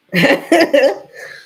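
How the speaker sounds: high, loud manic cackle; a demonstration of an unhinged laugh. Shown as a demonstration. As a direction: let out a crazed cackle on cue. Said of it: "Unhinged laugh".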